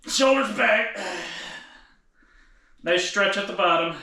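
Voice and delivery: straining. Real, not acted. Lifter groaning to viewers under a back smasher, straining. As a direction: push the words out mid-lift. Straining through set, in pain.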